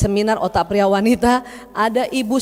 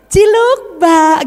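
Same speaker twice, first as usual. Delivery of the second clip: playful flirty husband; 7 semitones higher, 7 dB louder, much slower than usual. Acted.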